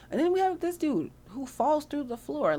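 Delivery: high, slow, amazed narration. Real, not acted. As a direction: disbelief at the clumsy fall. Sims falls through floor.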